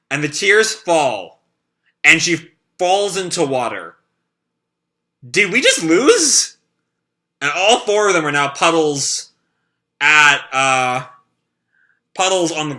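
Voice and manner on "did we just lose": slow, higher, louder; as if you can't believe it; stunned disbelief. Acted. Character stunned after defeat.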